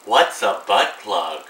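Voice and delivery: slow mock-innocent Anastasia voice; Anastasia impression. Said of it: "my Anastasia impression".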